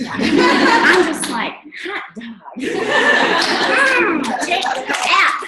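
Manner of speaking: laughing, high triumphant cheer; gleeful. Real, not acted. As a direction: crow it like you just won. Gleeful about their ranking.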